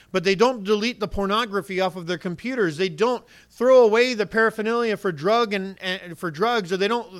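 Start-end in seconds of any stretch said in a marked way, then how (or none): none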